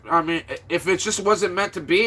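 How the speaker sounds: usual speaking voice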